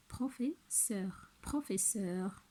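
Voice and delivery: "professeur" spelt out slowly, syllable by syllable; "professeur" said slowly, each syllable clear; slow syllable demo. Shown as a demonstration. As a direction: stretch "professeur" for the class to count. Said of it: "trois syllabes".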